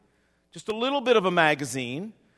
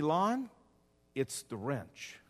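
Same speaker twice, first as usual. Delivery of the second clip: a hint of a low, slow divine voice, much softer; lightly voiced God, hushed and grave; voice of the Lord; 4 semitones lower, 10 dB quieter, much slower than usual. God answering the kneeling preacher, lightly voiced and hushed. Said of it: "the Lord said to me".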